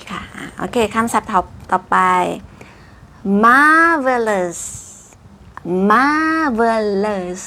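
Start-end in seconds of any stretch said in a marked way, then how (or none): 3.4-4.6 "marvelous" with a long, high, loud MAR; big slow teacher's "marvelous"; over-enunciated. Shown as a demonstration. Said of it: "ขยับปากเว่อร์ๆเลยเนาะ". 5.6-7.4 "marvelous" drawn out syllable by syllable; very slow, exaggerated "marvelous"; over-enunciated. Shown as a demonstration. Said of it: "ขยับปากเว่อร์ๆเลยเนาะ".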